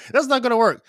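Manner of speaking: laughing, punchier repeat; laughing emphasis. Shown as a demonstration. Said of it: "that's, that's not gonna work".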